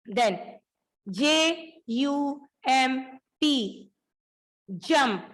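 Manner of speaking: letter by letter for the class; spelled out letters. Shown as a demonstration. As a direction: spell each letter slowly, then say the word. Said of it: "spelling aloud for class". (अक्षर-अक्षर बोलकर)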